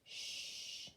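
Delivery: hushing shh, finger to lips; quiet the pretend baby; long shushing sound. Shown as a demonstration. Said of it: "finger on your lips".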